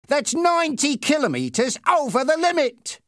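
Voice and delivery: high, loud reprimand; angry shout. A narrator's reading. Policeman scolding a speeding driver, angry shout. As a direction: shout the offence at high pitch. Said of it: "he shouted".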